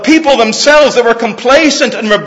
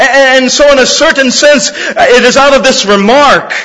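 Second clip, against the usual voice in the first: normal talking voice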